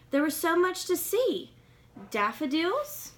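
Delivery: put-on lively read-aloud for kids; storybook reading. A narrator's reading. Reading a picture book to young listeners, lively storytelling.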